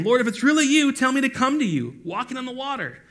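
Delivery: high, loud call across distance. A narrator's reading. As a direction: call out to someone far off. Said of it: "Then Peter called out to him".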